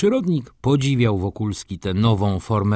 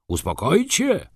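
much higher, indignant echo of the question; angry outburst; 9 semitones higher than usual. A narrator's reading. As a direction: fling the words back, reddening with anger. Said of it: "krzyknął dyrektor, czerwieniejąc z gniewu".

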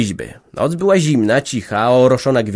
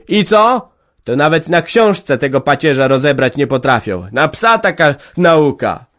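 loud, high peasant argument; heated table talk; 5 semitones higher, 5 dB louder than usual. A narrator's reading. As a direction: argue hotly over drinks. Heated argument over the school.

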